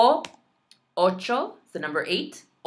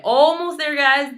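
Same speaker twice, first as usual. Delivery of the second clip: 1 cheering on; 9 semitones higher, 8 dB louder than usual. Real, not acted. Cheering viewers on.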